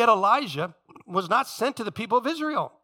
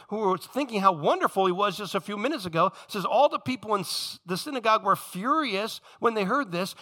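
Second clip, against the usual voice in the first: ordinary talk, nothing marked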